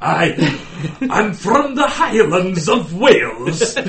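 loud, slow Scottish brogue, laughing; hammed-up Highland Scottish; Scottish Welsh. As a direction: proclaim it grandly in mock Scottish. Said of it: "he went Scottish".

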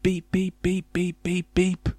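high, repetitive robot beeps; high-pitched robotic beeping. A narrator's reading. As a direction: sound a car computer's alarm, no real scream. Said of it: "screamed the car in a robot voice".

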